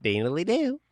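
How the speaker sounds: high goofy cartoon voice; silly clown voice. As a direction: toss it off like a cartoon.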